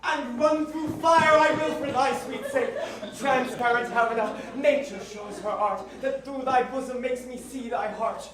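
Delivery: loud, high, rapturous declaration; ecstatic outburst. Acted. Enchanted Lysander waking and shouting his love to Helena. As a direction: burst awake swept up in love.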